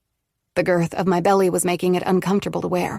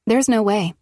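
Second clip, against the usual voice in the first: ordinary delivery, nothing marked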